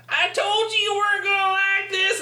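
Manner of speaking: high-pitched strained character voice. Acted. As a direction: squeal it out in a high voice. Acting out the burning scene.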